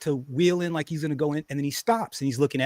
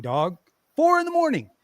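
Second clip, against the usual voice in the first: ordinary delivery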